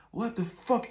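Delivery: outraged outburst, imitating the friend; imitated yelling. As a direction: act out his furious reaction. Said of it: "that's when he started yelling".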